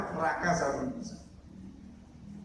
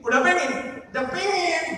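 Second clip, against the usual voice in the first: higher, louder insistent claim; mock eagerness; 10 semitones higher, 4 dB louder than usual. Preacher voicing one who claims to want heaven, mock eager. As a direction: push it out insistently, pitched up. Acting out someone eagerly wanting.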